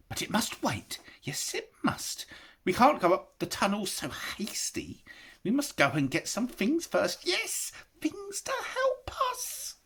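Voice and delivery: high whispering creature, ending in a sly laugh; Gollum, sly. A narrator's reading. Gollum making excuses to slip away, high sly whisper. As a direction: hiss excuses, sneaky.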